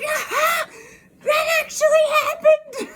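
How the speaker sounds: high, laughing shout of disbelief; laughing exclamation. Real, not acted. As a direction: burst out laughing in delighted disbelief. Owl surprise, laughing outburst.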